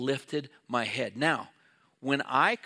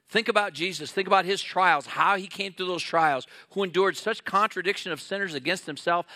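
louder, rising preacher's exhortation; a hint of pulpit fire, building; preaching; 3 semitones higher, 4 dB louder than usual. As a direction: push it out to the congregation with conviction.

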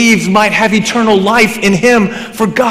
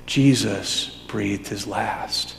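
normal talking voice

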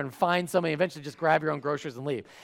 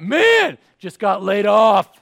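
mock gym-bro hype; 8 dB louder, much slower than usual. Preacher imagining someone bragging of hardship, mock hyped. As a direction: brag about bad news like a workout.

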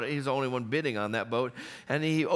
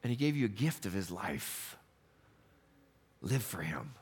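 quiet appeal; 8 semitones lower, 7 dB quieter than usual. Acted. Quiet close after climax.

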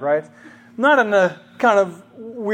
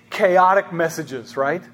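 normal talking voice

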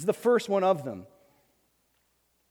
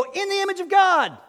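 awed exclamation; 12 semitones higher, 7 dB louder than usual. Pretend. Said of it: "you go".

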